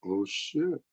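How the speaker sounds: ordinary talk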